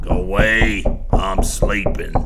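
put-on loud, slow snap from a half-asleep old man; snapping. A narrator's reading. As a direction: bark it at the door to make them leave. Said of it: "snapped Bryant".

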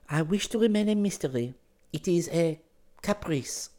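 put-on high, halting girl's voice; nervous girl. A narrator's reading. Girl explains her whim to him, nervously. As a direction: nervously make light of it. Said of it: "She laughed nervously".

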